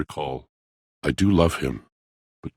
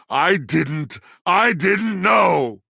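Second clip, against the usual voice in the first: anguished cry; 13 semitones higher, much slower than usual. Acted. Pleading innocence to the traveler, anguished high shout. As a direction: let it break out as a cry.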